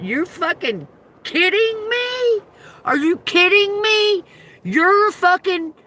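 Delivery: high, slow, drawn-out disbelief; mock disbelief. She mocks the counselor named Sunshine in high disbelief. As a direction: stretch it out, scandalized.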